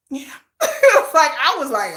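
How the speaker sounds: normal talking voice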